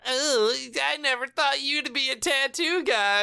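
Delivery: high, coy flirting voice; flirty Kate. Retold or imitated. Mocking Kate as she flirts with Jack, high and coy.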